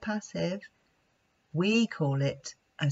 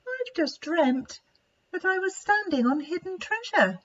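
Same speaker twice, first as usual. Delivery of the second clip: dreamy elephant; 12 semitones higher than usual. Acted. Lightly voiced Esther shares her dream, wondering. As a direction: tell the dream in awe.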